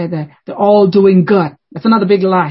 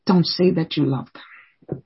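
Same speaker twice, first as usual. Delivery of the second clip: softer, slower repeat; 4 semitones lower, 6 dB quieter, slower than usual. Shown as a demonstration. Preacher repeats the warning to parents, softer and slower. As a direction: drop the voice and slow down on "Don't say that you love them".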